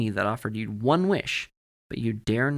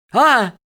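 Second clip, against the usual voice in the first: sudden loud, high yell; sharp yelp of alarm; startled shout; 14 semitones higher, 14 dB louder than usual. A narrator's reading. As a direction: cry out as if jumping back. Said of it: "he shouted and jumped back again".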